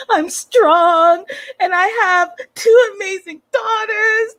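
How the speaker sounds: very high, tearful mock wail; hammed-up crying breakdown; Darcy. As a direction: overact sobbing with no real tears. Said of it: "it in your voice".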